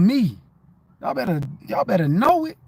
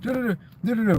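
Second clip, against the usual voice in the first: fast reading mimic; 4 semitones higher, much faster than usual. Shown as a demonstration. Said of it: "I used to try to read so fast".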